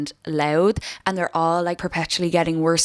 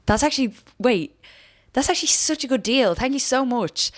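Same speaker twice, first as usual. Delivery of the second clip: mock-delighted, pitched up high; gushing thanks; 7 semitones higher than usual. Imitating herself gushing thanks to customer service, pitched up. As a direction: play the pushover thrilled by a fiver. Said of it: "and I'll be like".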